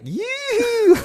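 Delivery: piercingly high yelled noise; awful high whoop. Retold or imitated. Runner recalls his noise at the driver, high whoop. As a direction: re-enact an awful taunting squeal. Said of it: "It was an awful noise".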